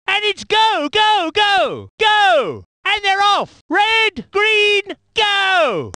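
high, excited shout; urgent commentator at the starting lights; race-start call. Acted. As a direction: launch the race with punchy cries.

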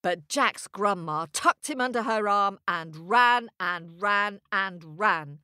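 ordinary delivery

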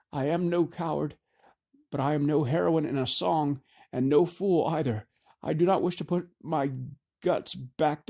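a frightened woman fighting back tears; tearful frightened woman. Acted. Jebra tearful and frightened, raised.

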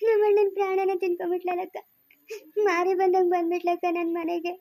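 acted sobbing lament with long tearful pauses; crying. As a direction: wail out your grief between sobs. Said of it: "ನೀನ್ ಅಳ್ತಾ ಇದ್ರೆ".

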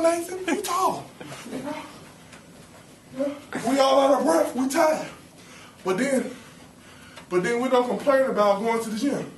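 normal talking voice

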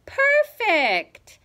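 high, loud exclamation; ringing, pleased cry; delighted. A narrator's reading. Ivy delighted with the flowers.

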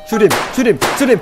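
high, loud, repeated command; urgent shout. Pretend. Player urges shooting the guy who appeared, urgent shout. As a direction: yell it in a game panic.

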